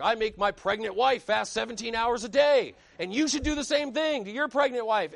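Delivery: imitating a loud, high-pitched boastful preacher; mock bragging. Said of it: "he's, like, bragging".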